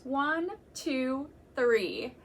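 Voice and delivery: ordinary talk, nothing marked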